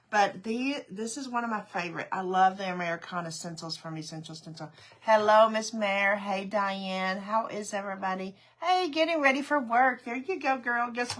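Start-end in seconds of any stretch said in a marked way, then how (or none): none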